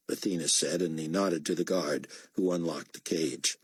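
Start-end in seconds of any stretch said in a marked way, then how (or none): none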